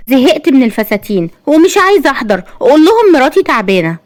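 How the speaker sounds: high-pitched protesting wife; high, complaining refusal; fed-up refusal. A narrator's reading. Wife refusing to attend party.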